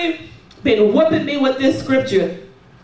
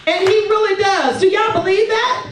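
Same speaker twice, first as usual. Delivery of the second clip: rising fervor; 8 semitones higher than usual. Real, not acted. Preacher rousing the congregation.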